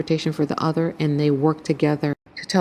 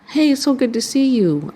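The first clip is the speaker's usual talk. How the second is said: high, eager welcome; eager greeting; 9 semitones higher, 3 dB louder than usual. Retold or imitated. Greeting a cousin eagerly, high and excited. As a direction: pour on the joy of seeing him.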